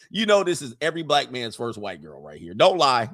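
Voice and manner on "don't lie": ordinary talk, nothing marked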